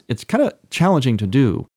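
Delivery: ordinary talk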